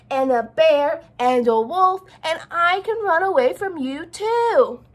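loud, slow, high shout. Acted. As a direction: yell it out slowly at full voice. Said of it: "he shouted".

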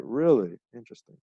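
barely react, let it drop away; uninterested. Acted. Partner answering her job news the wrong way, uninterested. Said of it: "No, you're like".

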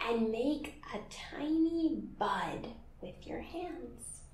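soft unvoiced whisper. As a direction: hush it for a tiny bud. Whispered instruction to kids.

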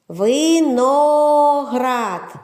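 Виноград very slow, with the О held long; виділений звук О. Shown as a demonstration. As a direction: stretch Виноград syllable by syllable. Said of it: "Є звук О". («Виноград» дуже повільно, з протяжним «о»)

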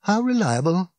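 rhetorical, lifted in pitch; hollow disbelief. A narrator's reading. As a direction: throw the question back, bleakly incredulous. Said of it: "He gave a hollow laugh".

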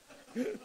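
a sudden high burst of real laughter; short laugh. Real, not acted. Preacher laughing at his own heated rant about crying, short laugh. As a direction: laugh at how heated you got.

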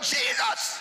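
very high, ringing yell; full-voiced high cry; demonstrated shout. Shown as a demonstration. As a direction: lead the crowd in shouting it. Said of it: "Somebody shout".

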